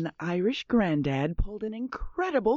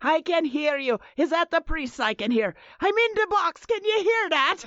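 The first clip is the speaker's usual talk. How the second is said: put-on Irish accent, pitched up towards the end; 5 semitones higher than usual. Prankster in the box to mourners, Irish accent. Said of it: "your accent's fantastic".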